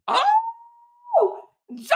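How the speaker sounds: very high, stretched gasp of joy; overwhelmed gasp. Real, not acted. Overwhelmed by donations.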